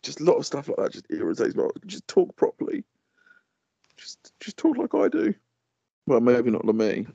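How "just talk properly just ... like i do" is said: high-pitched exasperation; exasperated plea. Real, not acted. Irritated by people saying things wrong, exasperated plea. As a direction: plead in a squeaked-up voice.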